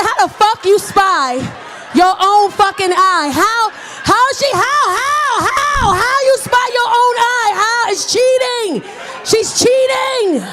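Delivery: high, loud and slow disbelieving shout; outraged protest. Retold or imitated. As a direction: yell in outrage at the cheating kid. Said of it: "I said".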